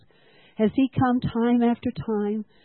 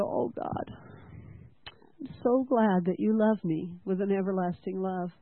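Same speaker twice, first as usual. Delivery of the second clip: hushed prayer; 7 dB quieter than usual. Retold or imitated. Retold private prayer, hushed.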